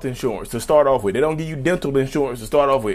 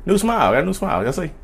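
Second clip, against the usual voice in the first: usual speaking voice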